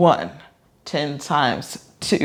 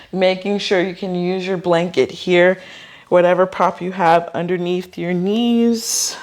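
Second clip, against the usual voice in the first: ordinary delivery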